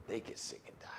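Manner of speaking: grim whispered warning. Real, not acted. Warning viewers about spreading virus, hushed whisper.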